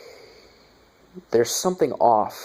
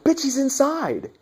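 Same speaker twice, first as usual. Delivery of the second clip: much higher, slowed, incredulous squawk; exasperated yell at screen. Retold or imitated. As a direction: shout at the dumb cop through the screen. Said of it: "I'm like".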